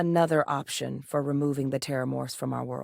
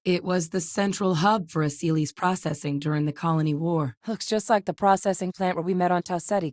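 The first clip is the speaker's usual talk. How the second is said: ordinary talk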